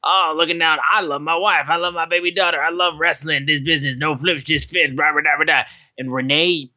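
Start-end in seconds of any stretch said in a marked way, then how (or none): 0.0-5.6 lightly mocking whiny sincere voice, a bit higher; sappy family-man parody trailing into blah blah; mocking Dax. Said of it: "and then Dax like".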